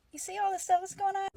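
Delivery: high, fast gossiping nun voice; light mock nun asking; imitating a nun. Nun gossiping to the other nuns, high and quick.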